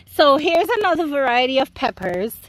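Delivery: ordinary talk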